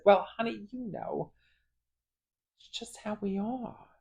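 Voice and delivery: high voice shrinking to very soft; mock-sheepish admission. Mother admitting to her child that she laughed, mock-sheepish. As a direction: imitate someone caught out, owning up softly.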